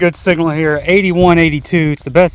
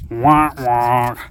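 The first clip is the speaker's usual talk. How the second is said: sad trombone noise; 3 semitones lower than usual. Real, not acted. Disappointed by the find.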